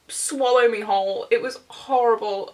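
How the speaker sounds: ordinary delivery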